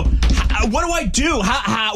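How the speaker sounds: high, stammering, hammed-up fluster; mock panic. Prankster begging scammer for help, mock panic. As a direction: act helplessly frantic. Pretending panic over cyber attack.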